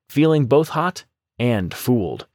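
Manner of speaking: ordinary delivery, nothing marked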